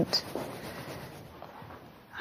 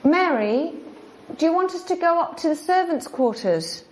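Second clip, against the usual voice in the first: raised voice, calling upward; talking to a ghost; 7 semitones higher than usual. Real, not acted. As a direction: call out to the spirit.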